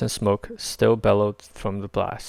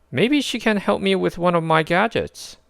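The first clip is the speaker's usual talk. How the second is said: higher, smirking raccoon; a cocky fast-talking critter; smirking raccoon eyes the newcomer; Rocket voice; 9 semitones higher than usual. A narrator's reading.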